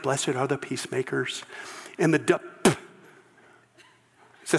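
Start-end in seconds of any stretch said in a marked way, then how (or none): 0.0-1.4 a lightly voiced soft, low pious Jesus; hushed storybook-Jesus impression; mock gentle Jesus. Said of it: "He's British, blue eyes, real thin".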